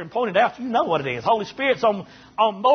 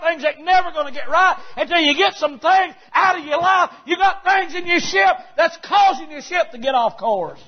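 preaching at full force; 6 semitones higher than usual. Acted. Preacher warning his congregation, shouting at full force. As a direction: drive the warning home to the congregation.

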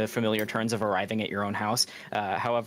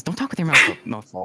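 scolding; 6 dB louder than usual. Acted. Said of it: "slaps Charlie on the back of the head and says".

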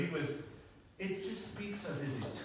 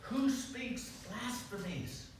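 high, scandalized mock outrage; indignant Pharisee quote, lightly hammed; 9 semitones higher, slower than usual. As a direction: voice the shocked scribes, pitched up.

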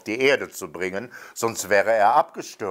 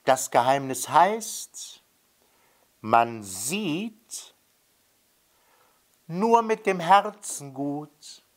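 solemn, tender; 6 semitones higher, slower than usual. A narrator's reading. Revealing the story's secret.

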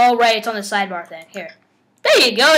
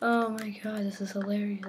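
much softer, spent from laughing; quiet; 3 semitones lower, 15 dB quieter than usual. Real, not acted. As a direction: murmur it, still amused. Quiet after laughing fit.

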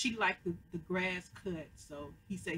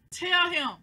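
high, loud push at the screen; loud urging; 10 semitones higher, 8 dB louder than usual. Real, not acted. Viewer urging Pete to tell Lucian, loud and pressing. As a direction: yell it at the TV to make him talk.

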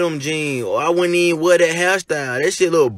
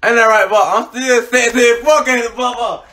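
playful boast; 8 semitones higher than usual. Real, not acted.

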